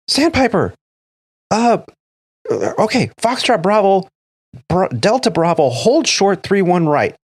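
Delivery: retold yell from the tower; controller yelling. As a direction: bark the hold short order at the crew. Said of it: "she's yelling on the thing".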